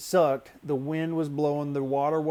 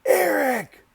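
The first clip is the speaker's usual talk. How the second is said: Eric yelled even higher and harder, near a scream; louder call; 13 semitones higher, 10 dB louder than usual. Retold or imitated. Calling Eric again when he doesn't respond, a shrill yell. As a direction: stretch Eric out at full pitch and volume. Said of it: "I'm like".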